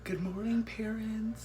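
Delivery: sing-song high greeting; chirpy mock announcer voice; high playful greeting.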